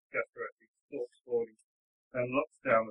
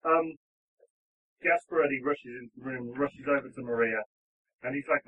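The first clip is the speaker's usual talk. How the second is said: ordinary talk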